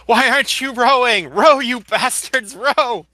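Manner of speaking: loud, high-pitched barking at the rowers; Charlotte's shout. Acted. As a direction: yell it to drive the crew on. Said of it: "listening to Charlotte shout".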